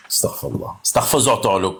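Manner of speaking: first Astaghfirullah whispered, the second voiced; mock-pious sigh borrowed from someone else; tongue-in-cheek religious exclamation. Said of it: "comme il dit l'autre".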